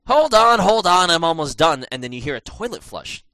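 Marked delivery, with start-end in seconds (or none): 0.1-1.8 higher, louder call through a door; guy in the bathroom. Retold or imitated. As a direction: call out busy from the toilet. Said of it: "you hear the guy going".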